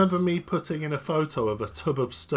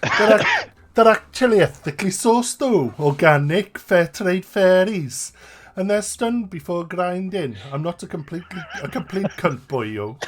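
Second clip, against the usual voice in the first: loud, high, indignant protest; Craig voice, loud and high; 7 semitones higher, 8 dB louder than usual. Pretend. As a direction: protest loudly, pitched way up. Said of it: "do you wanna take on Craig's part?".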